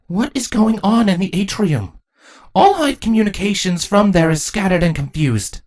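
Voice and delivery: high, commanding queen's voice; high-pitched regal concern. Acted. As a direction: demand a report from the captain. Queen questions her captain.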